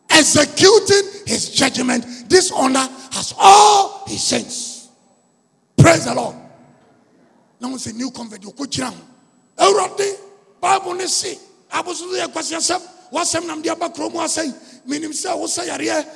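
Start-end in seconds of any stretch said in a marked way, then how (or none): 0.1-6.3 loud slow preached proclamation; full force preaching. Acted. Preacher proclaims judgment, loud and slow. 9.6-16.0 high loud shouted preaching; full force preaching. Acted. Preacher retells commanding the sick young man to rise in Jesus' name, shouting.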